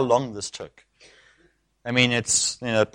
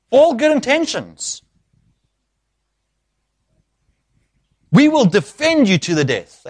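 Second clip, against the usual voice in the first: raised, higher-pitched sermon delivery; impassioned preaching; 9 semitones higher, 4 dB louder than usual. Acted. Preacher explaining why Peter resists, impassioned preaching. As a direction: drive each point home to the congregation.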